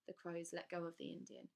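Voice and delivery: ordinary delivery, nothing marked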